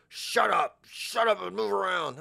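high, insistent mock order; bossy trainer. Fitness trainer ordering client, high and bossy. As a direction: push it out high like a pushy instructor. Barking the mock program name.

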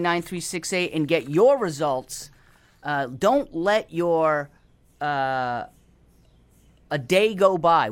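upbeat radio host plug; radio announcer. Acted. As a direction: sell it like a radio ad.

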